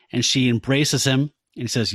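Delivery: usual speaking voice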